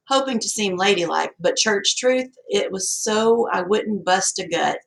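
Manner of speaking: plain reading voice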